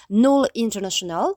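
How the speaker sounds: brand name said with a put-on English accent. Host pronounces the brand for listeners, in an English accent. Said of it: "tenez-vous bien avec mon accent anglais".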